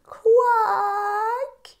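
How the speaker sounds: very high, crying quack; weary duck quack. Acted. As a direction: quack close to tears. Duck's reply.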